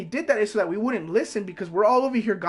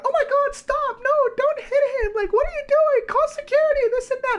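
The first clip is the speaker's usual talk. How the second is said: high panicked teacher squeal; freaking-out teacher; 5 dB louder than usual. Retold or imitated. Mocking a panicky teacher to viewers, high and shrill. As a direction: mimic a teacher losing it over a fight. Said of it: "some teachers will freak out".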